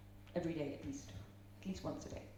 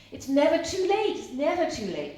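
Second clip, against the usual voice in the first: higher and louder, proclaiming it; emphatic declaration; 8 semitones higher, 8 dB louder than usual. Real, not acted. Speaker launching her next rule to the audience, loud proclamation. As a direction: announce it like a rallying cry.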